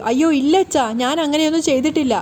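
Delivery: ordinary delivery, nothing marked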